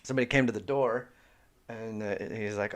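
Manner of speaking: ordinary delivery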